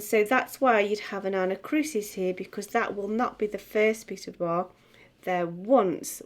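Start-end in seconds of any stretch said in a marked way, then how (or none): 5.2-6.2 ONCE leaned on, falling; stress on once. Shown as a demonstration. Showing pupils where the beat falls: stress on once. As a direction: hit once as the downbeat. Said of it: "once must be the first syllable".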